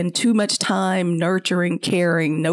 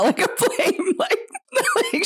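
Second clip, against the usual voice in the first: laughing. Real, not acted. Laughing at flame image.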